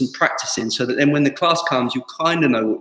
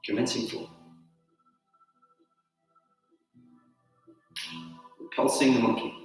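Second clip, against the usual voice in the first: soft, sparse form cues over music; name each move quietly as you flow; calling out moves; 10 dB quieter, slower than usual. Acted. Calls tai chi moves during form.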